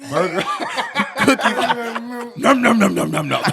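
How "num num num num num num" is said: loud playful chomping sounds; munching noises. Pretend. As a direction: chomp it like a hungry kid. Mocks eating a burger.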